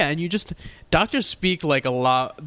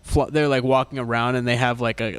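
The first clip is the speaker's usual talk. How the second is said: ordinary delivery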